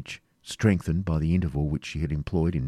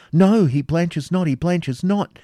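higher, insistent protest; louder opening, stretched emphatic denial; excited denial; 11 semitones higher, 6 dB louder, slower than usual. A narrator's reading. Rebecca excitedly defends the knight.

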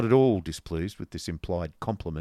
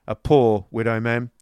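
"poor" pressed hard and louder; stress on poor; 3 semitones higher, 9 dB louder than usual. A narrator's reading. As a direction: lean heavily on "poor". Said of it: "with a powerful emphasis on that little adjective".

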